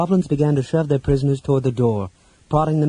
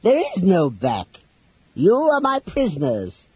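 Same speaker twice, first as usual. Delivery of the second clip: slow, high villain voice; villain at the door; 9 semitones higher, slower than usual. A narrator's reading. Captor in the doorway to trapped companions, villainous. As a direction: draw it out high, as a gloating captor. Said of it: "said a voice from the door".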